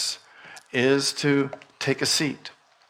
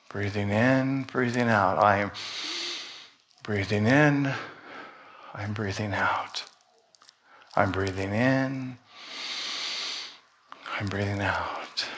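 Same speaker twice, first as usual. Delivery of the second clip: guided meditation; 2 semitones lower, 5 dB quieter than usual. Acted. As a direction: guide them gently through each breath. Leads breathing meditation.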